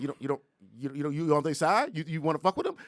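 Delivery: high, loud accusing challenge; worked-up, stammering challenge; retold confrontation. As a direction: imitate a man squaring up for trouble. Retold man confronts the couple.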